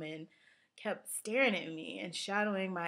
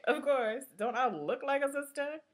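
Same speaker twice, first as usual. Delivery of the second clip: higher, louder sassy mock retort; sassy friend's retort; 8 semitones higher, 3 dB louder than usual. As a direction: act out an obvious of-course answer. Friend's indignant mistaken answer acted out.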